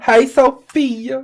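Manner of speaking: slow, sing-song hello; stretched friendly hello; drawn-out greeting. Real, not acted. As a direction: greet her sweetly and slowly. Greeting viewer by name.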